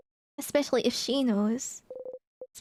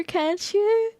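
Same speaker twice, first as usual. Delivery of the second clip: ordinary talk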